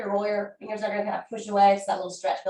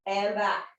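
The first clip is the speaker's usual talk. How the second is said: ordinary talk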